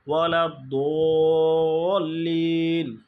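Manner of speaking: "ওয়ালা দোলিন" with a thick, very long "দো"; heavy, prolonged dad. Shown as a demonstration. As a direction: hold "দো" four beats, then stretch "লিন". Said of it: "তাহলে আবার মোটা করে". ("দো" মোটা করে চার আলিফ টেনে)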